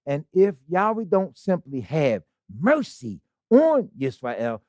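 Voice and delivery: ordinary delivery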